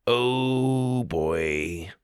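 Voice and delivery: soft, long drawn-out dread; said slowly. A narrator's reading. Sawyer caught without the guidebook, slow soft oh boy. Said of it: "Sawyer said slowly".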